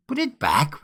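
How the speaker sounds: sharp rising cry of disbelief; astonished cry. A narrator's reading. Friends crying out at the young man's confession, astonished. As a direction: cry it out in shock. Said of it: "we cried out".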